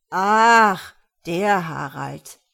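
loud, drawn-out realisation; feigned recognition. A narrator's reading. Gerda pretending to know Harald, drawn-out recognition.

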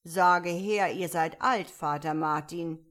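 loud, stern caterpillar voice; commanding caterpillar. A narrator's reading. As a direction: order a recital as the caterpillar. Caterpillar orders Alice to recite.

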